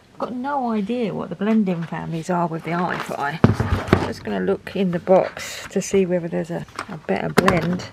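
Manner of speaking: normal talking voice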